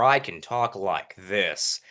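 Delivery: slow, deep demonstration voice; low pitch. Shown as a demonstration. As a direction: drop the pitch and drag it out.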